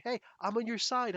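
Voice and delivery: high, pleading mock friendliness; imitating a fake ally; mock eager ally. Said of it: "people trying to say".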